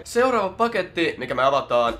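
mock game-show reveal; announcing. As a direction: present the next box like a showman. Announces next package.

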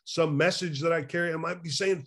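usual speaking voice

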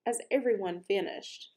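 ordinary delivery

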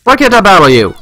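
high, whiny plea falling away; frustrated complaint. Real, not acted. Player asking the gym trainer why, frustrated complaint. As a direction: whine it high at an opponent.

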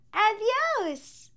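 ordinary delivery

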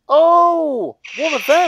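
high, loud burst of realisation; sudden delighted recognition; loud, high "oh, one of them"; surprised. Real, not acted. Sudden realisation about the fawn man.

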